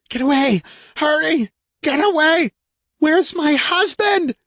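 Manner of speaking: high, frantic panicked shout. A narrator's reading. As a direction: scream to break free. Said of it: "Kay shouted".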